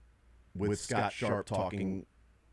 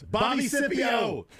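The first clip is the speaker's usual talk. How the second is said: high, drawn-out yell; slow, high-pitched playful holler; yelled text message; 13 semitones higher, 4 dB louder, slower than usual. Acted. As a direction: shout it like an all-caps text. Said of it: "text yells, capital, uh, capital letters".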